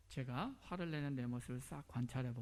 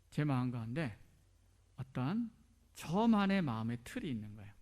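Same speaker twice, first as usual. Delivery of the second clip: ordinary talk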